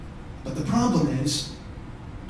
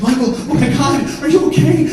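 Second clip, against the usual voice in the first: high, louder, worried cry; alarmed wife; 7 semitones higher, 6 dB louder than usual. Retold or imitated. Wife checking on Michael after the fight, high and alarmed. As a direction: imitate a wife in a panic.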